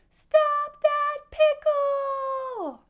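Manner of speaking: very high, loud, long-drawn yell; chasing shout. A narrator's reading. All the foods chase the pickle, high loud shout. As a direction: holler it at full stretch. Whole crowd of foods cries out.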